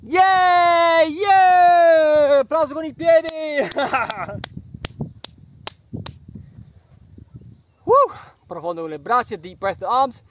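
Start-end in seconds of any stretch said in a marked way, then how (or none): 0.0-8.1 loud excited cheering. Real, not acted. Cheering kids on.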